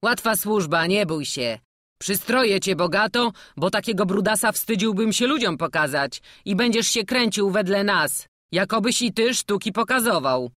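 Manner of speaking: put-on a jovial old jester coaxing the boy; hearty old showman. (dziarski stary kuglarz, głośniej)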